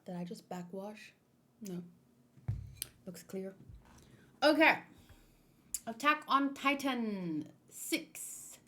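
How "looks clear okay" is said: ordinary delivery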